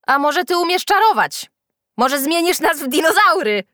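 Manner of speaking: high, loud jeering challenge; taunting shout. A narrator's reading. Freckled boy dares Julka to do magic, shouting. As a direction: goad loudly in a high voice. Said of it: "Piegowaty zawołał".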